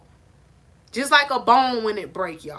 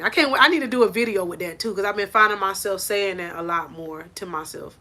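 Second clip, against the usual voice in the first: usual speaking voice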